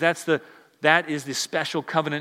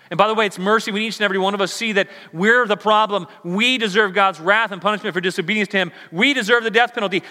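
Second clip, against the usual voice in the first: louder, higher, driving sermon voice; full-force preaching; 6 semitones higher, 6 dB louder than usual. Acted. Preacher pressing the point on the congregation, full force.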